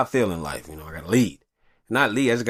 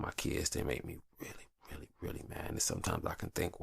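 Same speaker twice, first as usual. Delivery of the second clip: hushed admission; 4 semitones lower, 15 dB quieter than usual. Real, not acted. Confiding anger quietly.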